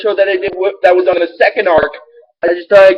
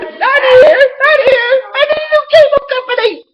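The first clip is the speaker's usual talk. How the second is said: much higher, cartoonish puppet voice, fast on the last line; hammed-up high cartoon villain voice; Puppetmon voice. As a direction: squeal it out like a panicked puppet.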